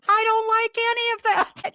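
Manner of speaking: high, loud mock outburst; emphatic declaration. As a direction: blurt it out as a frank confession. Acting out her own past declaration.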